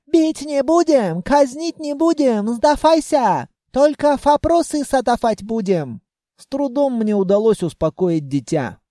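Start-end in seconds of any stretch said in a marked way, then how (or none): 0.1-6.0 mock yell, coaxing the enemy out; straining elves. A narrator's reading. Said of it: "надрывались светлые". (надрывно уговаривающие эльфы)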